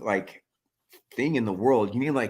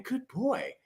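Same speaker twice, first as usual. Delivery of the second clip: dog praise; 13 semitones higher, 7 dB quieter than usual. Real, not acted. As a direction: coo it at the dog. Praising the puppy on Christmas.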